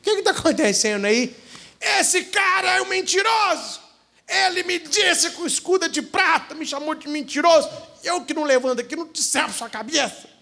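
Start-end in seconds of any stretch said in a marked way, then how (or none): 1.8-10.3 high furious yell; angry shout. Acted. Wounded warrior yelling to the peasant that the other lies. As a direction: shout the accusation at the liar.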